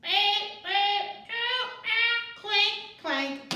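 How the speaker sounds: high robot beeps; robot noises. Pretend. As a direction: beep like a silly robot. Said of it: "pretend we're a robot".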